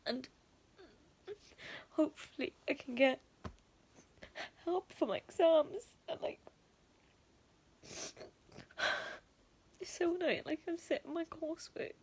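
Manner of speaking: fighting back tears; real upset, on the edge of crying; tearful. Real, not acted. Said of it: "I know I'm getting fucking emotional".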